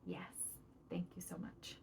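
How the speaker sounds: breathy whispered thank you. Shown as a demonstration. Parent thanks child for listening, whispered. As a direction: whisper your thanks gently.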